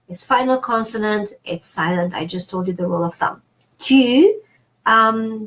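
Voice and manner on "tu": Tu with the French front rounded u; higher, louder demonstration of Tu, French vowel. Shown as a demonstration. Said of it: "the U in French is U".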